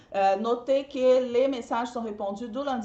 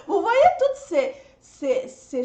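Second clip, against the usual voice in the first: laughing; 14 semitones higher, 11 dB louder, slower than usual. Real, not acted. Laughs at her own fears.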